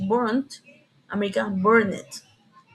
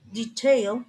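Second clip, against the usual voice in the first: usual speaking voice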